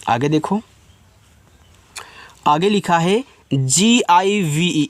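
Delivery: ordinary talk, nothing marked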